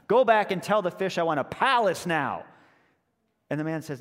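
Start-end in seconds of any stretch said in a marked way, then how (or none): 0.1-2.4 loud, higher insistent order; demanding wife. Acted. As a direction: demand it from the husband.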